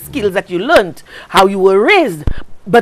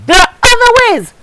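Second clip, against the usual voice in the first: high, pressing insistence; insistent; 11 semitones higher than usual. Real, not acted. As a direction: drive the point home hard. Insisting against beating children.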